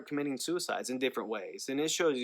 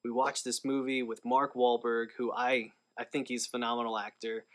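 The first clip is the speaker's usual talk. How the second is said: ordinary talk, nothing marked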